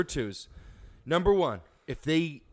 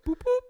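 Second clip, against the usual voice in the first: 1 radio beep imitation; 4 dB louder than usual.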